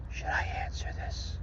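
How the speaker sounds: hushed, creepy imitation whisper; mock whisper. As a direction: whisper it furtively, mocking him. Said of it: "He's gotta whisper to his little".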